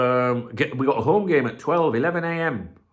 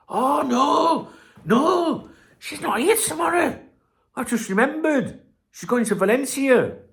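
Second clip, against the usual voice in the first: high excited realization with a laugh; light up, just remembered; oh no, she's away, mock panic; sudden realization. Real, not acted. Realizes partner away tomorrow.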